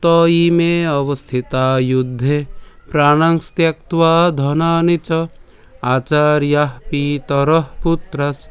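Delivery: slow, clear chant of the shloka; slow verse recitation. Shown as a demonstration. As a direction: recite each syllable carefully for learners. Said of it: "কিভাবে বলতে পারি". (ধীরে স্পষ্ট শ্লোক পাঠ)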